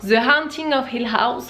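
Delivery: slow, careful English accent from a French speaker; self-mocking English accent. Shown as a demonstration. Vlogger showing her "superbe" English accent, slow and deliberate. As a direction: show off a would-be superb English accent, drawn out. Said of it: "avec mon superbe accent anglais".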